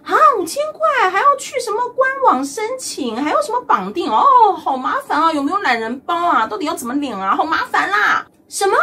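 high, whining fuss over every step; hammed-up moaning about the application; whiny complaint. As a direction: complain like a spoiled grumbler. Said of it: "或者是呢".

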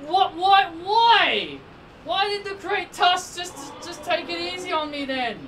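high-pitched, baffled outburst; astonished. Real, not acted. Gamer spared by Great Tusk, astonished. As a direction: start loud and high, utterly bewildered. Baffled by opponent going easy.

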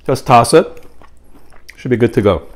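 talking while chewing; finishing a bite; mouth partly full. Real, not acted. Seen 1: Fork near mouth at -2; closed, working lips until about 1.2.

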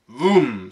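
loud booming bang sound; cannon boom. Acted. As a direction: make the cannon go off. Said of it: "sa kanonen".

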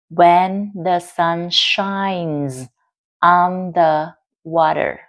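very slow, drawn-out dictation; slow, careful teacher reading; slow answer read-out. Shown as a demonstration. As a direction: stretch each phrase out for learners. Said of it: "Đáp án là".

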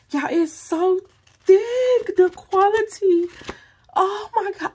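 high, loud, rising delight; squealing excitement. Real, not acted. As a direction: gush over it, voice climbing high. Raving about thick quality.

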